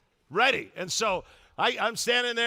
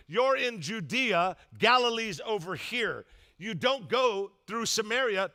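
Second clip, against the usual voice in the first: slow emphatic teaching; 2 semitones higher, slower than usual. Shown as a demonstration. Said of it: "explaining the map".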